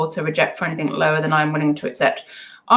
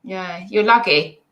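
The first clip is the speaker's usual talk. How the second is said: ordinary delivery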